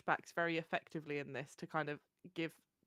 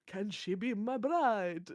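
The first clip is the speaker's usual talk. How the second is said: slow, lightly voiced Transylvanian accent; slow Transylvanian vampire voice, lightly done; 3 semitones higher, slower than usual. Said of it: "That was my Transylvanian voice".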